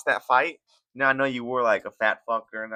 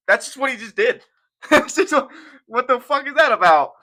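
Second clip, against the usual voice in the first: laughing; 11 semitones higher, 6 dB louder than usual. Real, not acted. Laughing at the tweet.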